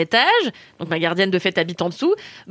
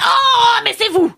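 high, loud surprised cry of the concierge; mock shout; 13 semitones higher, 5 dB louder, slower than usual. Concierge bursting out at the door, loud high surprised cry. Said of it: "elle sort, elle me fait".

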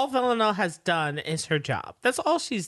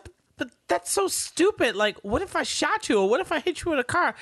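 higher-pitched mock incredulity; incredulous scolding; 8 semitones higher than usual. Voicing the assassin telling her rival the plan was stupid, incredulous. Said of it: "She said".

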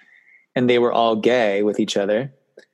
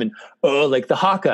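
ordinary talk

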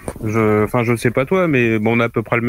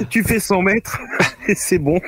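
laughing; 8 semitones higher than usual. Real, not acted. Laughs at joke about taxis.

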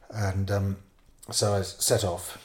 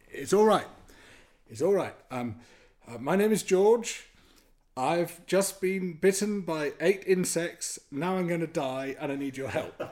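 higher, deliberate, spaced-out words; pleading reassurance; 9 semitones higher, 4 dB louder than usual. Retold or imitated. Stung stranger explaining himself to terrified villagers, mildly acted urgent plea. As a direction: spell it out to frightened listeners, urgently.

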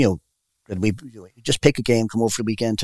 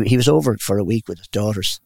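usual speaking voice

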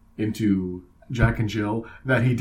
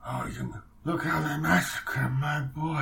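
higher, softened mock godfather; Marlon Brando; 4 semitones higher, 4 dB quieter than usual. Riffing on the film's grieving father, put-on godfather voice. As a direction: put on a mournful mob-boss voice. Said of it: "still sounds like Marlon Brando".

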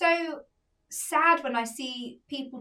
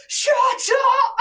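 mock yell, higher and louder; a passenger shouting at a crying baby; retold shout; 11 semitones higher, 8 dB louder than usual. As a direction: bark it across the plane. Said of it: "going again".

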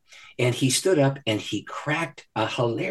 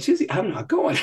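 higher, chuckling no; laughing refusal; 10 semitones higher than usual. Retold or imitated. Laughingly refusing Susie's request once more. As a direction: laugh through the stubborn refusal.

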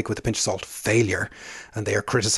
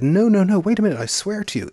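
high, pleading denial, much higher; frantic protest; 9 semitones higher, 4 dB louder than usual. Retold or imitated. Paul swears to the furious shopkeeper, frantic high protest. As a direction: insist desperately you meant no harm.

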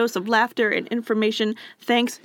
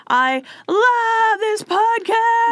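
silly high voice; 12 semitones higher, 3 dB louder, much slower than usual. Pretend. Reading review in silly voice.

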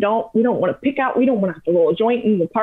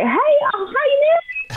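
lightly hammed-up big-personality tourist voice; mock Texan couple greeting. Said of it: "they're like".

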